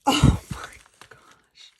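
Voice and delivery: a gasp then a loud, breathy, slow oh my gosh. Real, not acted. Finding another handmade piece in the box, loud breathy gasp. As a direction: gasp and let the words rush out on air.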